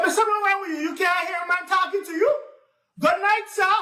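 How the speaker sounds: high, loud irritated scolding; vexed. Acted. Nancy vexed at the silent tar baby, high loud scolding. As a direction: scold the rude stranger. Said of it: "So Nancy get vexed".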